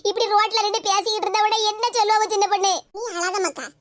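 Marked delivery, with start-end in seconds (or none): 3.0-3.7 softer, hushed soothing; calm her down tenderly; gentle comfort. Acted. An older woman hushes a crying girl, soft and soothing.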